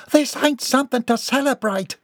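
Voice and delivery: high-pitched hillbilly wife scolding; outraged scolding. A narrator's reading. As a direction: scold your husband in a high voice. Wife scolds celebrating husband.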